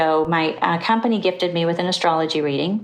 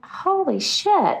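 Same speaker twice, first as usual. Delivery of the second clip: stunned; 12 semitones higher, much slower than usual. Retold or imitated. Stunned reaction.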